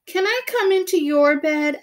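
high, hopeful little voice; small pleading voice. Acted. Little mouse begging big mouse for a place in bed, high and hopeful. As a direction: ask sweetly to be let in.

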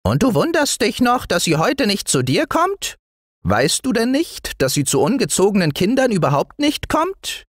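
high, reproachful little dwarf; piping gnome telling off a child; fine dwarf voice. A narrator's reading. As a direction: scold the boy in a tiny high voice. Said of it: "sagte der Zwerg mit feiner Stimme".

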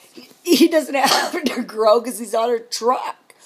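loud, slow words through laughter; real scornful laughter. Real, not acted. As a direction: laugh out the complaint. Laughs while complaining about husband.

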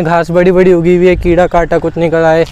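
ordinary delivery

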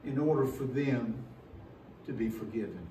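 low, quiet, fading acceptance; a hint of a solemn father accepting; God accepting the sacrifice, softly; lightly voiced God. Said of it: "and he said".

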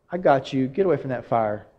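put-on God gently pulling a child from the fire, low and soft; protective reassurance. God voiced rescuing child.

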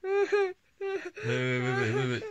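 wait, through giggles; real ticklish laughing. Real, not acted. Dad laughs while being scratched.

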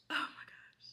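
breathless whispered oh my gosh; moved whisper; whispered awe. Real, not acted. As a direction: barely voice it, overwhelmed. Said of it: "teary-eyed".

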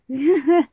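quick bright burst of laughter; short loud laugh. Real, not acted. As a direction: laugh out suddenly. Laughs at own phrase.

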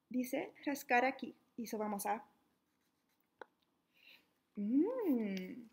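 plain reading voice

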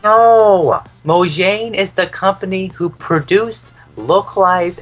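high, loud exasperated correction; exasperated shout. Real, not acted. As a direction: yell it in frustration. Exasperated arguing.

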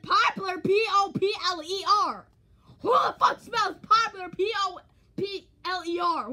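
high, loud exasperated spelling; incredulous outburst. Real, not acted. Ranting at their own misspelling, high loud incredulous shout. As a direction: shout each letter out in disbelief.

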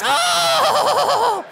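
shrill squeal of a puppet wolf; high puppet shriek. Acted.

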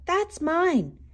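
high-pitched storybook claim; bear's yell. A narrator's reading. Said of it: "yelled the bear".

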